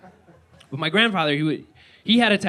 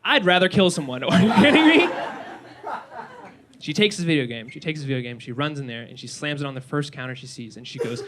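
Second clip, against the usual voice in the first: ordinary talk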